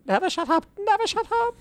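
very high mock jingle voice; squeaky put-on voice. Host calling out old show's name, squeaky voice. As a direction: pipe it up far above your normal pitch.

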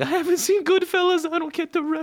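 high, whiny put-on voice; whiny contestant. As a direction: whine it like a teary contestant. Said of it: "contestants crying".

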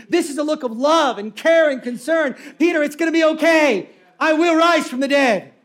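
loud, high preacher's proclamation; building to a triumphant shout; impassioned reassurance. Acted. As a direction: call out comfort to a friend across a crowd. Voices Jesus reassuring Peter, building.

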